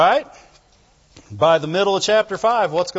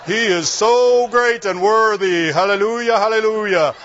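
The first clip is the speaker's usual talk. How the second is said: mock pious talk; 2 semitones lower than usual. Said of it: "they don't start talking like".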